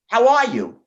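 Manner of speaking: high, loud automated chirp; phone menu voice. Retold or imitated. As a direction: chirp it like a cheery machine.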